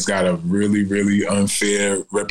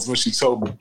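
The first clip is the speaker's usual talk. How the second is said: usual speaking voice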